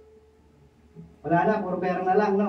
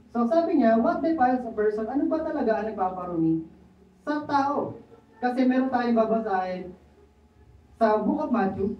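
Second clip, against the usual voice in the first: usual speaking voice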